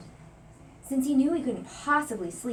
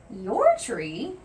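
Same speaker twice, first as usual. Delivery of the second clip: indignant surprise; 7 dB louder than usual. A narrator's reading. Joy indignant about the tree.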